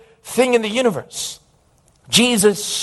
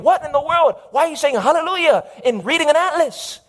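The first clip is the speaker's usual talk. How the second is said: irritated son; 10 semitones higher than usual. Acted. Irritated son questions father.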